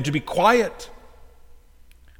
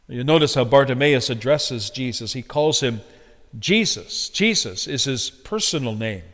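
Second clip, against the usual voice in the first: usual speaking voice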